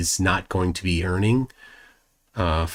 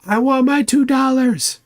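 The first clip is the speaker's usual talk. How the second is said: much higher, whiny demand for the money; Better Off Dead paperboy; 3 dB louder than usual. Acted. Streamer waving the bill quoting the movie, high whiny demand. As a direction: beg for the two dollars like the paperboy. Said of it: "Better Off Dead".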